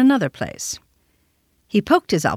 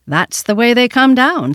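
raised retort; 6 semitones higher, 7 dB louder than usual. A narrator's reading. Winterbourne calls out a joking retort.

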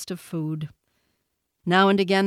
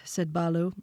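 ordinary delivery

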